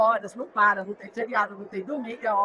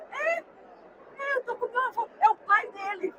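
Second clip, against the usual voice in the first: vozinha aguda de criança, imitada; voz de criança; 11 semitones higher than usual. Retold or imitated. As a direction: responda como criança pequena, bem agudo. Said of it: "uma criança se aproximou". (criança explicando, em voz aguda)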